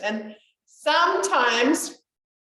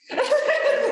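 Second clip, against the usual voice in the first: sudden high giggle; real laugh; 5 dB louder than usual. Real, not acted. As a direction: burst out giggling at your own joke. Laughs at her own joke.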